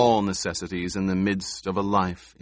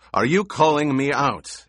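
higher, louder challenge; demanding challenge; 7 semitones higher, 6 dB louder than usual. A narrator's reading. Stilgar to Paul, who defies him, demanding. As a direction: demand an answer, voice raised. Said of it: "Stilgar demanded".

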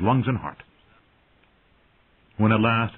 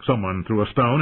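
usual speaking voice

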